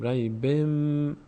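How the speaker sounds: ریبم with a long held final m; n turned to m. Shown as a demonstration. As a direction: draw out ریبم, lips closing into m.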